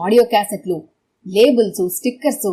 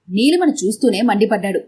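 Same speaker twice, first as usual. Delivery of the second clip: plain reading voice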